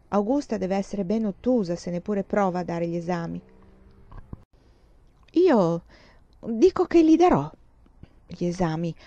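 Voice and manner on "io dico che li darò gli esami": put-on a girl declaring she will take the exams, loudly; defiant insistence. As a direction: insist you'll do it anyway.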